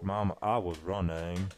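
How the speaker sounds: slow, low Southern drawl; Forrest Gump. Acted. Showing friends the film character, slow Southern drawl. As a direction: drag it out like a simple Southern boy. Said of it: "Forrest Gump's a lot more like this".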